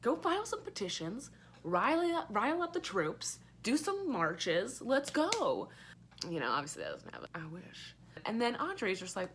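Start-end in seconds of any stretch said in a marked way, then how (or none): 0.0-5.7 much higher, slow egging-on voice; playfully rallying the angry pilots to march; mock rallying cheer. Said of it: "And I'm like". 6.3-8.0 fast unvoiced whisper; hushed letdown aside. Real, not acted. Deflated aside after the rally.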